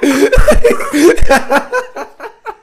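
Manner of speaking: big loud burst of laughter. Real, not acted. Friend cracking up at his own disclaimer, loud laughter. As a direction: laugh out loud, high and hard.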